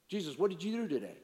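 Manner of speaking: brisk, chatty question; quick casual question. Pretend. Man making small talk with Jesus, quick and casual.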